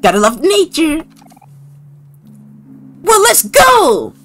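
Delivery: high, drawn-out cartoon shout; excited game character. Character glad of fresh air at last, high excited voice. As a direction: burst out in a high, exuberant character voice.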